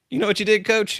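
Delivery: grown-up player calling his coach out, amused; former player. Retold or imitated. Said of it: "And he said".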